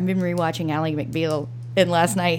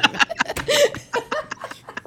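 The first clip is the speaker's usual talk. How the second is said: high laugh. Real, not acted. Laughing at the joke.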